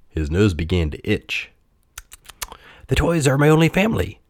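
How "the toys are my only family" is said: high, fast little voice; rabbit. A narrator's reading. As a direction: chatter it high and quick. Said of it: "said a rabbit".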